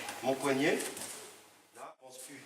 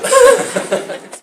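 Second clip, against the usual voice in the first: loud high laugh; 13 dB louder than usual. Real, not acted. Laughs after joke.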